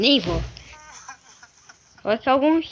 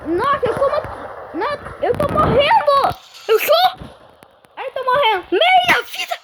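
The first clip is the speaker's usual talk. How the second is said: panicked wailing; 11 semitones higher than usual. Real, not acted. Gamer losing health, wailing in real panic. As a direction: shriek in fear of dying in the game. Taking damage, about to die.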